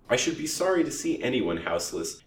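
usual speaking voice

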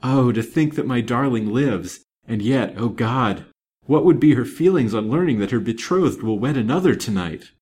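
loud, impassioned, anxious outburst; feverish anxiety. A narrator's reading. Banker frets over his daughter's betrothed, feverishly anxious. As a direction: agonise aloud, pressing each phrase hard. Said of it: "in a fever of anxiety and impatience".

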